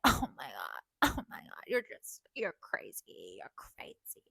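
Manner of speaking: low slow mock gasp, fading to a whisper; mock shocked friends. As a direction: mimic friends gasping at your antics. Friends reacting, mocked.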